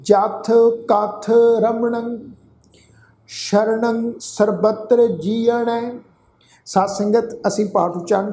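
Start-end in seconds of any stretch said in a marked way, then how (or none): 0.0-5.8 slow, measured chant of gurbani; slow scripture recitation. Shown as a demonstration. Teacher reciting the verse to the congregation, slow and measured. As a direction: recite each word slowly and deliberately. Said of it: "ਇਹਨੂੰ ਸੁਣੋ ਜੀ ਧਿਆਨ ਨਾਲ".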